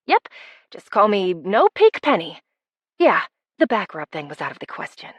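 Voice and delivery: ordinary delivery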